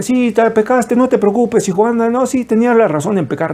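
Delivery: high-pitched pampering voice; mock coddling. Preacher mimicking what people want to hear, mock coddling. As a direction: coddle the sinner in a put-on high voice. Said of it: "quiere escuchar el apapacho".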